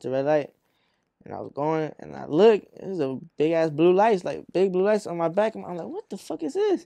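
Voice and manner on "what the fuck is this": retold disbelief. Driver reacting to police lights behind him, high mock disbelief. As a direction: replay being baffled, voice jumping way up.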